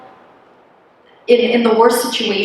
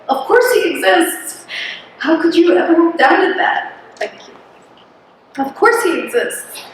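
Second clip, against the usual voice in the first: much higher, bursting with a reliving certainty; sudden realization; 8 semitones higher than usual. Retold or imitated. Retelling her first prayer after ten years, voice lifted in amazement. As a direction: relive the moment faith hit you, lifted and amazed. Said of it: "the first time that I felt like".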